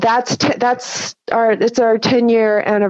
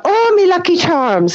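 Irish accent; 7 semitones higher than usual. Shown as a demonstration. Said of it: "say you're trying to do an Irish accent".

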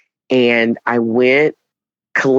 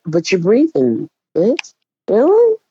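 sassy retort; 6 semitones higher than usual. Retold or imitated. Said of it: "I'm like".

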